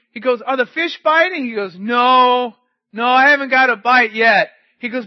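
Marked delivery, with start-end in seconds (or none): 0.4-1.5 high, loud shouted question; calling out. Retold or imitated. Driver asks the boy about his fishing, calling out. 1.8-4.5 loud, raised hollered answer; calling back. Retold or imitated. Fishing boy replies to the passing driver, calling back.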